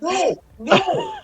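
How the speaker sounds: very high mock protest; protesting cultist. Cultist arguing back, high-pitched protest. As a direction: object in a high, squeaky put-on voice.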